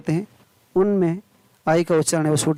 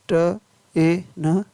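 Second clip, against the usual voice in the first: slow, separate phonics sounds; word broken slowly into its sounds; sounding out; much slower than usual. Shown as a demonstration. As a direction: say each sound on its own. Phonics teacher sounding out spelling.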